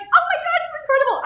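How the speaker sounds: very high, loud gushing; imitating thrilled friend. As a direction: act out a best friend's squealed delight. Voices best friend's delighted reaction.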